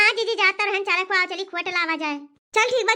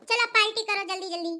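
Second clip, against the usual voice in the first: usual speaking voice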